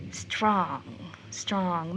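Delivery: softly turning the name over to herself; quiet musing. Acted. Rachel dreamily repeating the young man's name, quiet. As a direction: murmur the name, lost in thought.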